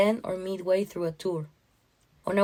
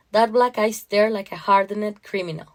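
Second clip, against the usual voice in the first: plain reading voice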